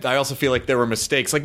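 ordinary delivery

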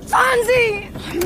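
sehr hoch, langsam gedehnt geschrien; verzweifelter Schrei. Acted. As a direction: zieh das Fahren lang, voller Verzweiflung. Urging the driver to flee. (Sandra fleht den Fahrer an, endlich loszufahren: verzweifelt, hoch, gedehnt)

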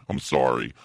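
usual speaking voice

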